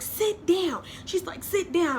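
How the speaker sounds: high, loud commanding order; bossy sister. Retold or imitated. Sister ordering her to sit down, high and bossy.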